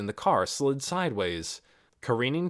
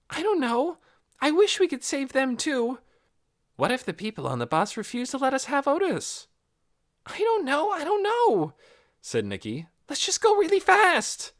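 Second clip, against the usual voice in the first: put-on high, loud fretful girl voice; girl fretting about the dog; anxious girl; 4 dB louder than usual. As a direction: worry out loud. Nikki anxious.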